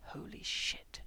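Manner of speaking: hissed whisper of shock. Acted. Frank realizing the truth, hissing in shock. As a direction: breathe it out in a stunned hiss. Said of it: "he hissed".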